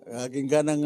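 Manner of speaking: ordinary talk, nothing marked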